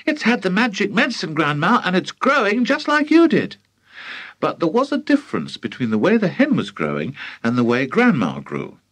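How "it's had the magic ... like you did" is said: put-on high, quick, thrilled boy; excited shouting. A narrator's reading.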